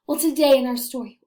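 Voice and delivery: ordinary delivery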